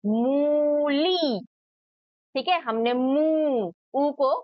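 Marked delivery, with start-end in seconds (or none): 0.0-1.4 "मूली" with both vowels heavily stretched; higher, slow "मूली", leaning on oo and ee; long ऊ and ई. Shown as a demonstration. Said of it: "लंबा स्वर से इसको खींचा है". 2.9-3.7 "मू" with the oo stretched out; long ऊ. Shown as a demonstration. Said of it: "लंबा स्वर से इसको खींचा है".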